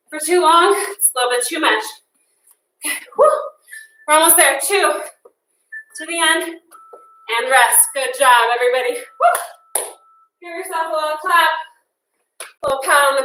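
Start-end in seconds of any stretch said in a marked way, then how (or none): 2.8-3.5 high, breathy whew of relief; real tired exhale after the sprint; relieved whew. Real, not acted. Exhale after intense workout set. 8.9-9.8 high, voiceless little woo; a tired, airy cheer; real breathy whoop of relief. Real, not acted. Cheering class after intense part.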